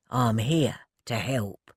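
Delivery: slow, low and gentle; soothing. Acted. Ted calming Elsie, slow soothing. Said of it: "he soothed".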